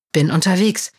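usual speaking voice

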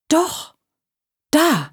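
sudden high startled doch; a startled gasp of a word. A narrator's reading. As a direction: jolt upward in surprise. Startled at hearing voice.

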